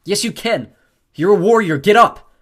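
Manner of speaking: high, building to a yell; urgent, loud encouragement; rallying shout. A narrator's reading. Rallying friend mid-fight.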